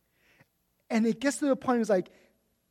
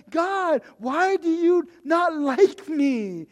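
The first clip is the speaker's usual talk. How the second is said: whiny prayer; 6 semitones higher, 4 dB louder, slower than usual. Retold or imitated. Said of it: "that's how I feel".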